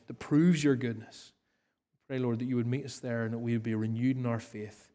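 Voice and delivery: hushed pastoral prayer. Acted. Leads closing prayer.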